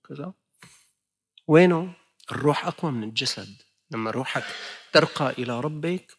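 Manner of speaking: usual speaking voice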